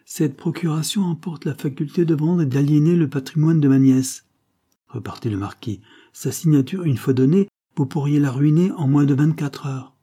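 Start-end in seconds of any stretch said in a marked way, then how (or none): 0.1-4.3 a higher, louder, stern nobleman; the uncle laying down the law; lightly voiced marquis, firm and disapproving; stern old marquis. A narrator's reading. 6.1-9.9 a higher, louder, stern nobleman; the uncle laying down the law; lightly voiced marquis, firm and disapproving; stern old marquis. A narrator's reading. Marquis warns the duke.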